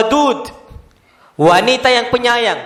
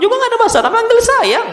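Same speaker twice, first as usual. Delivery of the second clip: playful; 12 semitones higher, faster than usual. Real, not acted. Preacher playfully suggesting sweet name.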